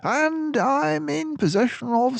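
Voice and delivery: high, loud, mannered detective voice; Basil's voice. Acted. Basil reassures gloomy Dawson on the train, high and hearty. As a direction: play the confident mouse detective.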